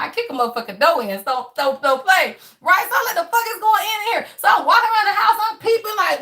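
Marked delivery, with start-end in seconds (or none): 3.2-4.3 high, fast demand; half-laughing, sharp question; mock-confused. Retold confusion.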